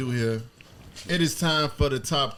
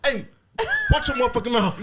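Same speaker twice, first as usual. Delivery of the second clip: raised, higher angry warning, half laughing; retold angry snap; 10 semitones higher, 4 dB louder than usual. Dad telling off his son for cursing him, retold angry. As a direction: retell a pissed-off dad's warning with amusement. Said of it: "and I was pissed".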